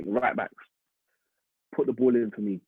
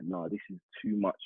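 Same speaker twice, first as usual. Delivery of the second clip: overwhelmed murmur; 4 semitones lower, 6 dB quieter than usual. Retold or imitated. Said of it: "I was like".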